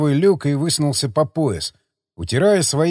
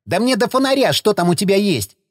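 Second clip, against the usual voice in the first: high, defiant snap; shrieking retort at the sergeant; snapping back; 6 semitones higher than usual. A narrator's reading. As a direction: bite his head off. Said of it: "Непривычно дерзко огрызнулся Загорский".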